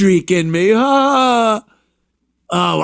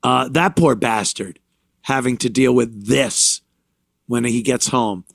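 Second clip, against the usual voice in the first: ordinary talk, nothing marked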